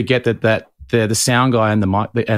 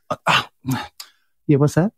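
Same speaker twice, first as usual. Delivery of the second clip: mock-condescending, higher pitch; 4 semitones higher, faster than usual. Shown as a demonstration. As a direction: make hearing them sound like a big effort. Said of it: "do you do it condescendingly?".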